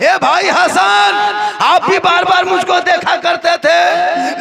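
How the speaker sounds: wailing plea. Acted. Husain asking his family why they stare, high wailing lament. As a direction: plead as a child sensing something wrong. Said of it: "इमाम हुसैन फरमाते".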